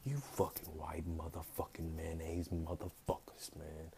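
hushed, slow whisper under the breath; slow muttered whisper of contempt; whispered insult. Real, not acted. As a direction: whisper the insult slowly, almost to yourself.

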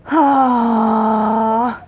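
long voiced sigh of dismay; long distressed sigh. Real, not acted. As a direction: let out a drawn-out, pained "oh". Reader upset by the story.

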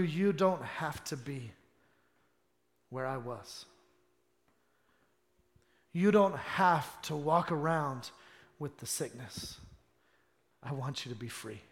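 low and soft, spaced by pauses; earnest, subdued preaching; hushed pastoral appeal. Real, not acted. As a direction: plead quietly with the congregation.

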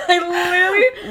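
high, loud, slow words through real laughter; can't stop laughing while talking; crying with laughter on the podcast; laughing to tears. Real, not acted. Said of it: "Apology tears now".

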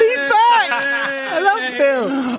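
high loud squeal of delight; excited. Real, not acted. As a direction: cheer for him. Excited at Bill's return.

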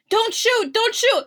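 high, pleading call; a lightly acted plea from a man in the movie; pleading bystander. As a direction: beg them not to shoot. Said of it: "he's like".

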